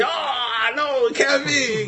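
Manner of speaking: high, drawn-out gasp of disbelief; retold shocked protest; disbelieving crowd. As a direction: wail it slowly like stunned onlookers. Crowd gasps at final feat.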